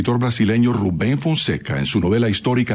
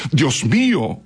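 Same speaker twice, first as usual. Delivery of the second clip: higher-pitched, mildly dramatic exclamation; 6 semitones higher, slower than usual. A narrator's reading. Brother-in-law finding the president shot, exclaiming. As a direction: read it as a narrated cry, pitch lifted. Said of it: "exclamó Saramaño".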